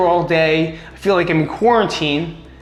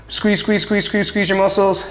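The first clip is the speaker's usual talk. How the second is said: each squeeze pushed higher; demonstrating the squeeze; 5 semitones higher than usual. Shown as a demonstration. Coach counting out squeezes to the viewers, pitched up. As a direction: pump out squeeze, squeeze, squeeze like an exercise rhythm. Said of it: "to pretty much squeeze".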